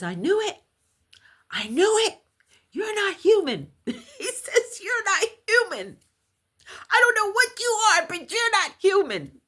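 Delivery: astonished man's cry, imitated in a high voice; high-pitched retold outburst. Friend's husband to the storyteller after the eerie event, imitated high outburst. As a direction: exclaim it very high, rising to a squeal.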